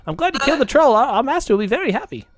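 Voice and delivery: high kobold voice with halting repeats; squeaky little servant creature; shivering kobold. Acted. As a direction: pitch it up, eager to please. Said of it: "they're shivering and they're like".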